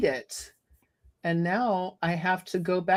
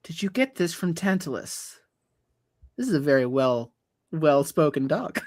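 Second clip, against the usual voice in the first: well-spoken dog head; 4 semitones lower than usual. Acted. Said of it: "what it is saying to the exile".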